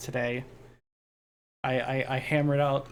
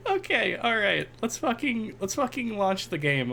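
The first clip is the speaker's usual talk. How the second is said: giggly; 8 semitones higher than usual. Real, not acted. Still high from laughing.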